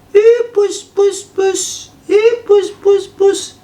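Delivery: very high falsetto sing-out; calling a cat. Retold or imitated. Girlfriend lures the wary cat, high coaxing. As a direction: coax the cat in a squeaky falsetto.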